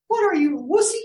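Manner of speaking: very high, mocking little voice; taunting inner voice. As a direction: needle him like a schoolyard taunt. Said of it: "this little voice in my head".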